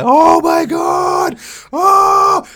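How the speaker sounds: huge high shouted rapture over food; ecstatic shout. Retold or imitated. Retelling the first bite, ecstatic high shout. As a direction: shriek with delight at the food.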